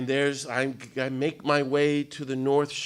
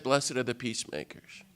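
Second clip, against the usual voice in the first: quieter, a grave hush; soft and hushed; 2 semitones lower, 8 dB quieter than usual. Retold or imitated. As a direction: let it fall soft and solemn. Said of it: "even at the place where he said".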